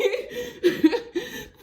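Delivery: high burst of laughter; real laugh. Real, not acted. Laughing at her own scared thirteen-year-old self, high laugh.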